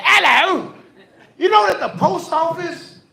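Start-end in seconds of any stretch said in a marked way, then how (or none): 0.0-0.7 high, loud sing-song British "Hello."; hammed-up British greeting; mock British hello. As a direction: chirp "Hello." like a cheery Londoner. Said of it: "Everybody sounds like that over there".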